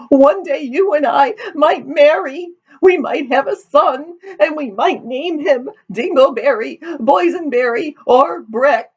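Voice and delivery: high, hammed-up tearful wail; weeping. A narrator's reading. Daughter tells her suitor about their son, weeping. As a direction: sob through it in a very high voice. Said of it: "weeping all the while".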